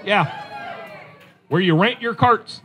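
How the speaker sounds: normal talking voice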